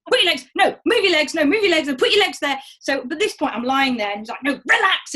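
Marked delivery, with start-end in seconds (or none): 0.1-2.6 loud, higher impatient commands; bossy doctor. Retold or imitated. Doctor barking leg positions at patient, loud and impatient. Doctor ordering her legs. 4.4-5.1 loud higher shout of relax. Retold or imitated. Doctor shouting at tense patient, loud and higher. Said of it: "And he's shouting".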